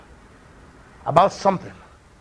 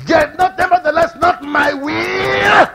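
high loud drawn-out plea; Jesus pleading; 10 semitones higher, 5 dB louder, slower than usual. Acted. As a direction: stretch "not my will" slowly. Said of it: "Jesus said".

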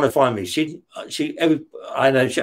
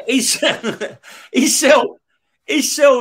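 laughs out the words, pitched higher and louder; laughing; 9 semitones higher, 3 dB louder, much slower than usual. Real, not acted. Laughing while telling the host about Frank. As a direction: break into a laugh on it.

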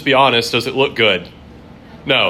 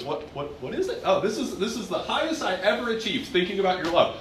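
puzzled God; 8 semitones higher, 7 dB quieter than usual. Pretend. God puzzling over our theology.

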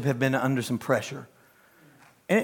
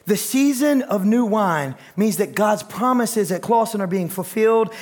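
usual speaking voice

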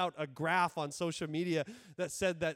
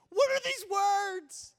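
very high, incredulous outcry; mock exasperation; 9 semitones higher than usual. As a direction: protest in pitched-up bafflement. Baffled listener reacting to slang.

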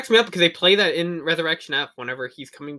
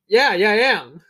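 mock proud; 5 semitones higher, 10 dB louder, slower than usual. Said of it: "And I was like".

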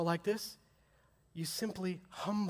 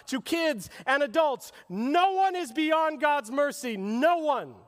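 higher and louder, building to a ringing appeal; climbing preacher's cry that peaks then holds; full-force preaching; 9 semitones higher, 6 dB louder than usual. Acted. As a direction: preach it out to every people group.

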